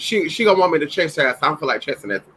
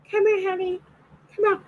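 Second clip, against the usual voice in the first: high sweet call to the dog; 5 dB quieter than usual. Real, not acted.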